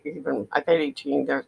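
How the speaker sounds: usual speaking voice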